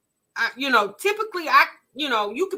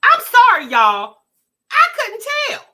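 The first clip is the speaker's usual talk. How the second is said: exclaimed apology; 8 semitones higher, 10 dB louder than usual. Real, not acted.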